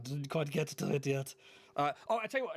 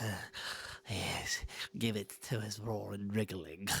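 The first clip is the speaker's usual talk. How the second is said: lower, slow slimy demon voice; 4 semitones lower, 3 dB quieter, slower than usual. Acted. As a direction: drawl it like a greedy devil. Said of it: "devils and demons will be like".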